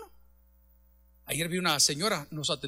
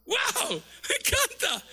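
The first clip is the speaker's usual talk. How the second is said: much higher, excited wow; real delight, pitched way up; much slower than usual. Real, not acted. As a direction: burst out in joy at the promise. Preacher delighted by the promise.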